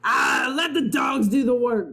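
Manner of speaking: a captain shouting to let the crew dig; pirate shout. Acted. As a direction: wave off the crew's trouble.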